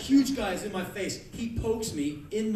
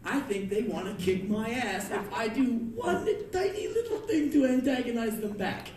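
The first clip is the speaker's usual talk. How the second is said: ordinary talk, nothing marked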